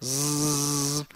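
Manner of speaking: soft bee buzz; a long soft bee buzz for the child. Shown as a demonstration. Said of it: "a abelha".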